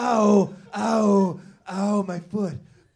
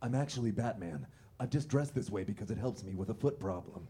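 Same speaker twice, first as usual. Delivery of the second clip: much lower, softer gravelly hero; low Batman voice; 7 semitones lower, 7 dB quieter, faster than usual. Acted. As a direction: growl it like Batman. Batman speaks low to Gordon.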